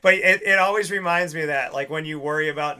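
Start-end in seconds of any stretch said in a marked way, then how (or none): none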